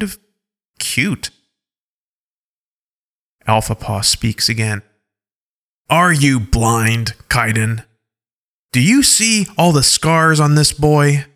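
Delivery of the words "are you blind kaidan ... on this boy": slow then raised scornful challenge; mock his blindness; scornful wolf leader. Alpha Paw scolding Kaidan over the boy's scars, scornfully.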